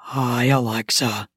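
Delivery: low male character voice; Ben. Acted. Neighbor greets the wife on entering, low-voiced. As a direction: drop deep for the neighbor's greeting. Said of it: "he said".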